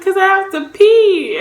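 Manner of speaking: high, loud acted plea; re-enacted loud whine; whiny protest. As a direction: plead your case, hammed up.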